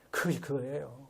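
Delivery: low, soft dismissive aside; dismissive quiet aside. Shown as a demonstration. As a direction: toss it off under your breath as a shrug.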